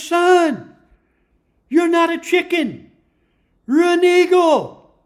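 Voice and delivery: loud, high call down from the sky; father eagle yelling. Acted. Father eagle yells down to his son in the coop, loud and high. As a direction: shout it so the son hears from far above. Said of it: "he yells out to him".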